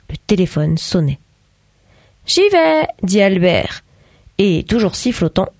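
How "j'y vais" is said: high, bright "J'y vais", lightly voiced; cheerful father. A narrator's reading. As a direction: pop up cheerfully to get the phone. Albert jumps up to answer.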